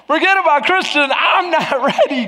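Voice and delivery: high, laughing protest; mock protest. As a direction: protest to God through a laugh. Retold protest to God, laughing.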